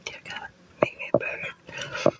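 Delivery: hushed, breathy whisper of a prayer. Pretend. Praying to God for wings, in a slow whisper. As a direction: whisper the wish slowly up to God.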